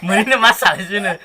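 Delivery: amused, laugh-broken telling. Real, not acted. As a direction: tell it through real giggles. Laughs while telling naked-six tale.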